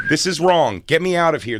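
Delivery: hammed-up distressed wife; mock panic. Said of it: "it's kinda like a".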